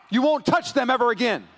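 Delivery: high, loud protective warning; enraged roar. Acted. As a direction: roar it at the enemy of your cubs. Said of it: "lion roaring at its enemy".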